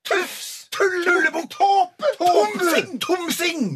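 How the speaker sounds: very high screeched insults; screaming scolding. Acted. Father's thoughts scolding him for spoiling Christmas, screaming. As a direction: fire off each name as a strained shriek. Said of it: "kjefter og skriker de".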